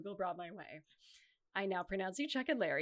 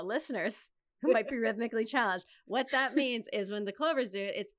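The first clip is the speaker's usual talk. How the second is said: ordinary talk, nothing marked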